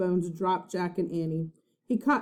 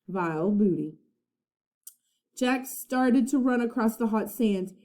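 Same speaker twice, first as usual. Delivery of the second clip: usual speaking voice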